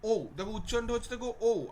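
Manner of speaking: ও said short and high as a glide, not a full হর্ষও; high, loud teacher demonstration; half-vowel demo. Shown as a demonstration. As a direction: show the short ও sound. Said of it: "হর্ষও বলে উচ্চারণ করছি না".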